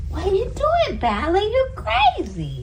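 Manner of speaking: very high squeaky cooing. Real, not acted. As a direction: squeal at the silly cat. Owner baby-talks to her cat.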